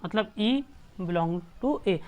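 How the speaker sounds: slow, word by word; slow dictation. Shown as a demonstration. Teacher shows students how to read the notation, slowly. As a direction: read "ई belongs to ए" slowly for the class. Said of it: "इसको कैसे पढ़ेंगे?".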